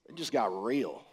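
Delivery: ordinary talk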